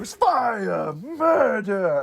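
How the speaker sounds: put-on theatrical alarm call; game character's cry. Imitates the game's alarm cry.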